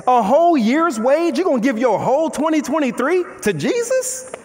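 ordinary talk

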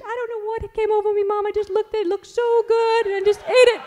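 put-on very high childish voice, a touch tearful; high, rushed little-kid plea; little boy voice. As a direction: play a sheepish second grader confessing to mom. Voicing young son's tearful excuse.

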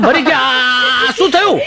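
high, drawn-out cry of dismay; hammed-up panic; mock alarm. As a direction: stretch it out in comic shock. Acts alarm at the misunderstanding.

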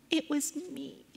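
put-on very soft, small child's admission; hushed confession. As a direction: confess it almost under your breath.

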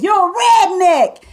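retold loud slow taunt; loud slow insult. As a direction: shout the insult at her slowly. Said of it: "and I said".

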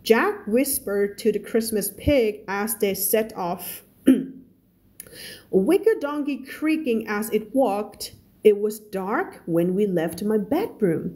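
slow, careful read-aloud of a novel; story reading. Acted. Teacher reading a story to her students, slow and careful.

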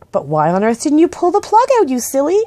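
put-on high, incredulous girl's question; exasperated scolding. A narrator's reading. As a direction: scold with rising disbelief. Girl scolds the silly bear.

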